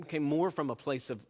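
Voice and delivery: ordinary talk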